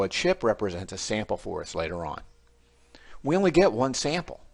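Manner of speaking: normal talking voice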